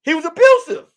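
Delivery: shocked call-out from the crowd; mock outraged disbelief; outraged surprise. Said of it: "people came out and was like".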